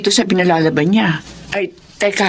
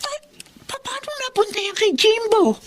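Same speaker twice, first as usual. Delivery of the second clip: alarmed disbelief; 12 semitones higher, 6 dB quieter than usual. Acted. Mother alarmed by the bracelet.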